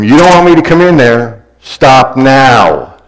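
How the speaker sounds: mother at the end of her patience; mom's warning shout. Acted. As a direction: warn the kids from the next room. Mom warns from the other room.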